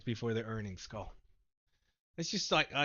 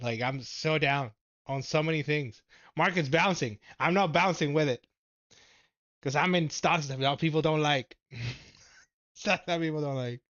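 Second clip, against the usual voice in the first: loud, raised-pitch complaint; frustrated venting; 3 semitones higher, 6 dB louder than usual. Real, not acted. Streamer complaining to viewers about stocks, frustrated. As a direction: complain loudly, voice pushed up.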